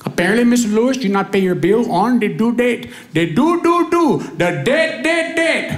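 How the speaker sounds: put-on Indian accent. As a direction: stumble over the words in an Indian accent. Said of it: "talk to somebody got an accent".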